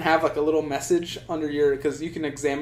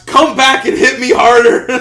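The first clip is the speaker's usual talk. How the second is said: full-volume, high-pitched mock shout; all-caps shout; 9 semitones higher, 14 dB louder than usual. Slapped player shouting after his attacker, hammed-up all-caps yell. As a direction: blast it out like text in all caps. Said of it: "And then in all caps".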